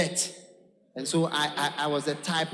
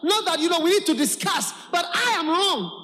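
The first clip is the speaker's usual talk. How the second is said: acted outburst; 8 semitones higher, 5 dB louder than usual. Acting son's outburst, shouted.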